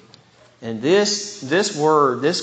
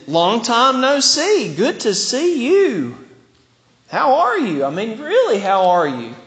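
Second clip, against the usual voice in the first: delighted greeting; 7 semitones higher, 3 dB louder than usual. Shown as a demonstration. Seeing an old friend after a long time, delighted. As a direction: greet them like you really mean it. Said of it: "As in".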